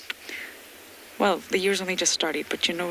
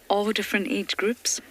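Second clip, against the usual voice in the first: normal talking voice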